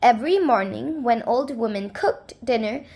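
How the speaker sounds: ordinary delivery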